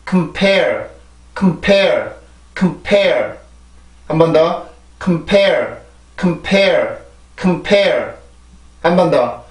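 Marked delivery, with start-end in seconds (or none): 0.1-3.4 "compare" with a tiny first syllable and long falling "pare"; stress on second syllable. Shown as a demonstration. Teacher drills a word for learners to repeat, stress on second syllable. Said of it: "여기가 강세 음절이죠. 두 번째 음절이". 5.0-8.1 "compare" with a tiny first syllable and long falling "pare"; stress on second syllable. Shown as a demonstration. Teacher drills a word for learners to repeat, stress on second syllable. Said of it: "여기가 강세 음절이죠. 두 번째 음절이".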